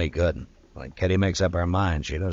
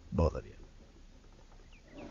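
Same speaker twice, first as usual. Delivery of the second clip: quiet threat; 6 semitones lower, 14 dB quieter, much slower than usual. Acted. Marshal threatens two men.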